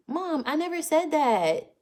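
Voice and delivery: high, drawn-out whine at mom; mock-indignant protest. Real, not acted. Daughter answering her mom's comment on stream, whining protest.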